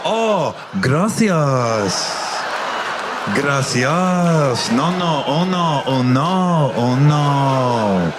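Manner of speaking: mock Japanese accent on Spanish words. Comic to Japanese waiter, mock Japanese-accented Spanish. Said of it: "speaking Spanish in a Japanese accent".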